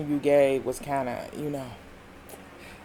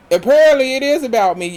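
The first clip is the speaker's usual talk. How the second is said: higher, louder, fast sarcastic jab; heated rant; 8 semitones higher, 6 dB louder, faster than usual. Retold or imitated. Sutton ranting back at Kyle, heated sarcastic jab. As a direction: spit it out quick and sarcastic.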